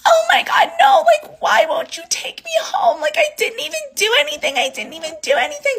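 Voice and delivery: imitating a very high-pitched wailing meltdown; mock a toddler's screechy, tearful protest; screaming toddler tantrum. Friend refused a ride home, imitated as screaming like a toddler. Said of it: "screaming like a fucking toddler".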